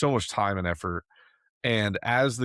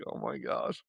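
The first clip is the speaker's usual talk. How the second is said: hushed dismay; 3 semitones higher, 9 dB quieter than usual. Retold or imitated. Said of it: "I was like".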